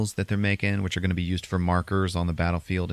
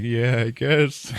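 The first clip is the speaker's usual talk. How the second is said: higher, drawn-out mock reluctance; reluctant agreement; 5 semitones higher, 3 dB louder, much slower than usual. Preacher accepting a grim warning, mock reluctant agreement. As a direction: stretch out a half-hearted concession.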